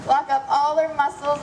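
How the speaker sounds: ordinary delivery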